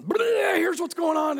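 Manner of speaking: mock blurt shouted high. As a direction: burst it all out at once. Blurting out instead of tact.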